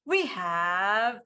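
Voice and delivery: slow, drawn-out emcee announcement; big guest introduction. Real, not acted. Host bringing on the next speaker, slow showy introduction. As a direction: build it up and stretch out the name.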